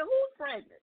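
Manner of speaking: stunned question, acted out; shocked. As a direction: replay your shock as a high question. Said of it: "I was in such shock".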